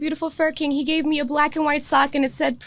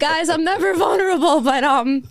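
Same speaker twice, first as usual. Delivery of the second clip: raised voice, laughing through tears; overcome, confess it through happy tears; crying happy tears; 3 semitones higher, 3 dB louder than usual. Real, not acted. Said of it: "but I'm crying".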